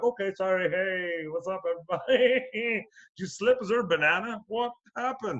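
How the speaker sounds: high, teasing fake concern; mock innocence. Handcuffed man sits and needles the fallen cop, mock innocent. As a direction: taunt him in a high playful voice, laughing.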